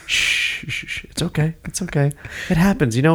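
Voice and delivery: calm bad guy comforting a victim; really calm. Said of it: "really calm".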